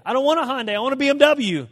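high, loud mock tantrum; hammed-up insistent whine; bratty protest. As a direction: refuse it like a spoiled child. Acted tantrum refusing a Hyundai.

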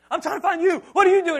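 loud, high half-laughing exclamation; relieved dad hollering at his son; exasperated yell. Retold or imitated. Father finds son in bathroom.

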